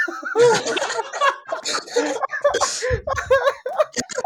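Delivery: wheezing high-pitched laughter; can't stop laughing; high hysterical laugh. Real, not acted. Hysterical laughter after blooper.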